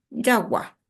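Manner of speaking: "jagua" stressed on its final a; spoken accent. Shown as a demonstration. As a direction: lean on the last vowel of "jagua". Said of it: "jagua ha'e moanduhe pureko". ("jagua" con la voz cargada en la última a)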